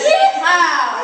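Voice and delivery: loud laughing yeehaw; cowboy yell. Pretend. Comic boasting of his Texas birth, loud yeehaw. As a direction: whoop it out like a rodeo cowboy.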